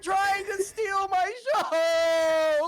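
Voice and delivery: loud, high, drawn-out mock wail; tearful breakdown. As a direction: bawl it out slowly, overacting the sobs. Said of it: "breaks down a little bit emotionally".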